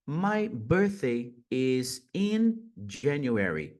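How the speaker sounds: slow "My birthday is in January" with weight on "in"; slow model sentence. Shown as a demonstration. Teacher slowly models a birthday sentence. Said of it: "Já fica essa dica extra".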